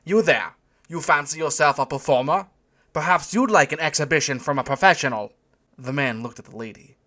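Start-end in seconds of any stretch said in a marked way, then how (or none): 0.0-5.3 put-on loud, higher German-accented challenge; German calling out. A narrator's reading. German doorman challenging a joker, loud accented call. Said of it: "the German called out to him".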